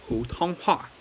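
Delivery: Putonghua: Pu dipping, tong high and level, hua falling; Putonghua with tones. Shown as a demonstration. Teacher modelling the term for learners, careful Putonghua with tones. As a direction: say Putonghua slowly, tone by tone. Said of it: "third tone, first tone, fourth tone".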